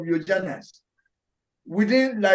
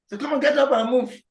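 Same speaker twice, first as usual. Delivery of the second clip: rough messenger order; 7 semitones higher than usual. Acted. Messengers driving the suffering soul.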